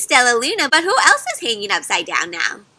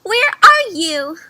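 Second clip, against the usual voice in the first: squeaked; 6 semitones higher, 6 dB louder, slower than usual. A narrator's reading. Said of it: "Stellaluna squeaked".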